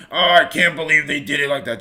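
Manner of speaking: mimicking a lazy couch critic scoffing at athletes; mocking couch critic. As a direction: sneer like a snack-eating know-it-all on the couch. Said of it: "they'll be like".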